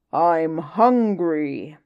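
slow, loud, booming whale voice; hungry whale. Acted. Whale demands food from the little fish, slow booming voice. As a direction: rumble it out like a giant hungry whale. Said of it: "the whale stood up on his tail and said".

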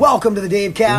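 loud, high hype greeting; goofy shout. Acted. Greeting the podcast audience with a loud high shout. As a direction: yell hello like a hype man. Layered goofy intro voices.

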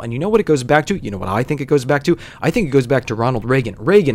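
fast, insistent political tirade; imitated rant. Customer explaining his presidency theory to bartender, fast rant. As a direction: rattle it off like a man on a pet theory.

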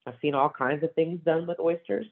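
usual speaking voice

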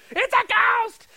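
high, loud mock terror; hammed-up ghost scream; panicking. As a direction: yell it like the boat is panicking.

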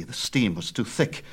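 plain reading voice

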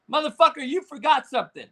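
ordinary delivery

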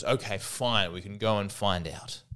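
low, soft, resigned agreement; grudgingly giving in. Acted. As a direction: concede the point reluctantly. Second cannibal gives in.

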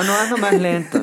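laughing mock demand. Musician imitates a demanding singer, laughing. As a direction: tease the demand while laughing. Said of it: "No te dicen".

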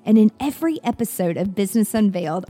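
loud, punchy show-opener voice; upbeat podcast host intro; podcast intro read. Acted. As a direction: sell the show like an announcer.

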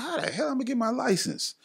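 incredulous. Retold or imitated. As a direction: scoff at the very idea. His own retold disbelief.

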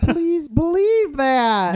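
high, slow fake wail; mock crying promo. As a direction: whine it like a weeping wrestler. Joins mock promo, whining.

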